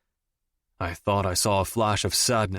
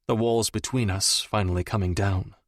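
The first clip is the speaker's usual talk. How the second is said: plain reading voice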